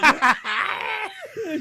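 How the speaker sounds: loud, high witch cackle; hammed-up witch laugh. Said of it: "And she goes".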